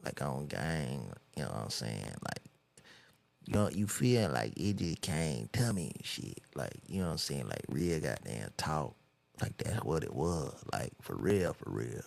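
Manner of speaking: low, mumbled mock rapper talk; incoherent rapper impression. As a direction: slur through filler phrases like a rapper. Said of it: "they, they all the same shit".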